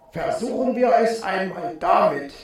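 muffled inner voice; thinking to himself. A narrator's reading. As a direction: say it inside your head. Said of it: "dachte er". (gedämpfte Gedankenstimme)

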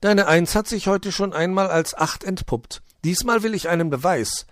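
high, loud little elf demanding. A narrator's reading. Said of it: "forderte das Wichtelfräulein".

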